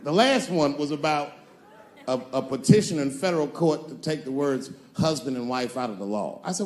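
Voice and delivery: usual speaking voice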